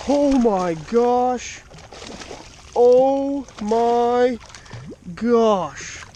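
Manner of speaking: high, loud, drawn-out oh my gosh; real amazement at a huge fish; stunned shout of delight; excited gasp. Real, not acted. Huge trout appears on line.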